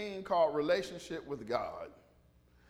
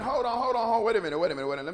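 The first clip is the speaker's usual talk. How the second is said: louder, insistent objection, repeating yourself; urgent interruption; 4 semitones higher, 6 dB louder, much faster than usual. Retold or imitated. Peter interrupts Jesus to object, urgent interruption. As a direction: cut in hastily and loudly. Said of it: "And Peter went".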